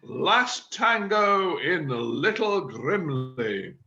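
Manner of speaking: very slow, drawn-out announcement; grand title reveal. Acted. Chairman tells the group his play's name, grand reveal. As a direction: unveil the title with slow relish.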